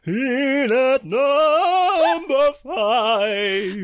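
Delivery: very high, slow wailing ghost. Pretend. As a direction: wail it out like a ghost. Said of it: "do it in your best ghost voice".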